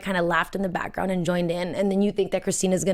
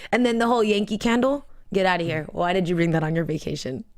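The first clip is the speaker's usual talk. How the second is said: normal talking voice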